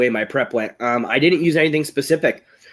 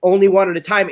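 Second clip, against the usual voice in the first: higher-pitched mock yell of warning; raised, insistent coaching shout, retold; retold screaming; 6 semitones higher, 4 dB louder than usual. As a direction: urgently call out to stop him, without a full scream. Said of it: "I started screaming".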